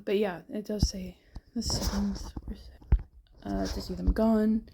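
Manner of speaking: losing voice, barely voiced; voice dying. Real, not acted. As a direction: voice fading to a breathy whisper. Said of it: "my voice changed drastically".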